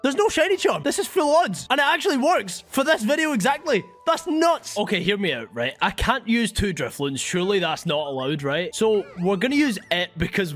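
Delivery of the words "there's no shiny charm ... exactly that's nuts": high excited disbelief; real thrill at the find. Real, not acted. Shiny surprise reaction.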